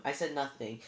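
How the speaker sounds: ordinary delivery, nothing marked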